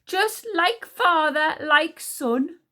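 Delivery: loud, high, slow scorn; raging. A narrator's reading. Queen scorning father and son, raging. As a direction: rage it out slowly and scornfully. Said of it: "raged Queen Sycorax".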